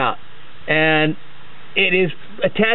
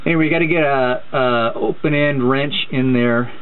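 ordinary talk

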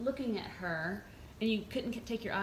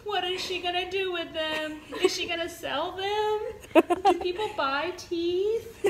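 high, slow, woozy mock whine; imitating loopy patient; 10 semitones higher, 12 dB louder, slower than usual. Wife retelling her drugged husband's rant, high slurred mock whine. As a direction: play the drugged friend after surgery, high and slow.

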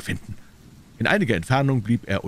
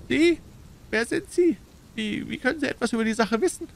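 hohe, aufgeschreckte Stimme; verunsichertes Nachfragen. A narrator's reading. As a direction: misstrauisch und stockend nachhaken. Startled little man questions stranger. (erschrockenes Männlein)

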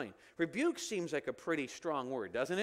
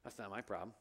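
lower, softer, brushing it off; dismissive; 9 semitones lower, 9 dB quieter, faster than usual. Retold or imitated. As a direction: shrug off the complaint. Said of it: "what they're gonna say".

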